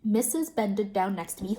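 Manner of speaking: plain reading voice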